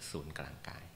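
breathy whisper, barely voiced; hushed meditative whisper. Shown as a demonstration. Meditation instruction, soft cue.

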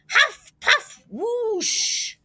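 high, puffed sound effects; acted huffing and blowing. A narrator's reading. Wolf blowing the house down, puffed whoosh. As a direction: blow the words out, ending in a long whoosh.

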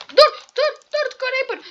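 high, loud alarm at the creeper; panicked stop. Real, not acted. Gamer yelling at a creeper, high panicked alarm. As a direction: yell stop in sudden fright.

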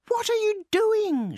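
high, drawn-out mouse shout. A narrator's reading. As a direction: cry out slowly in a very high mouse voice. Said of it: "shouted Guru".

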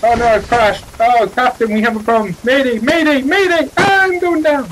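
high, loud mayday cries; mock mayday panic. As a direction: ham up the crash. Helicopter crashing, mayday calls.

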